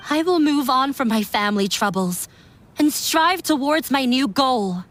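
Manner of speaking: normal talking voice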